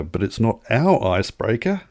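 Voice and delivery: ordinary talk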